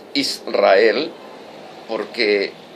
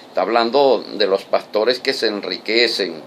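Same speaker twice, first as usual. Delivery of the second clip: ordinary talk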